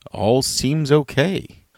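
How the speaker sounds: ordinary delivery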